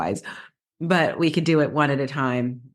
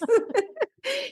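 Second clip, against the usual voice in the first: high giggle. Real, not acted. Amused by bosom photo story.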